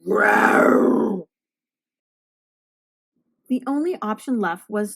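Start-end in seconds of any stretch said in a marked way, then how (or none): 0.1-1.3 loud growling roar; angry monster growl. Acted. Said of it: "made him angrier".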